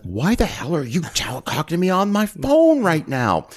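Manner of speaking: a hammed-up irritated producer barking into the phone; mock angry outburst.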